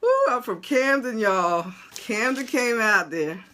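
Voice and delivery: loud, boisterous hometown boast; amused outburst. Real, not acted. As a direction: crow about the Camden side escaping. Laughing after confronting a troll.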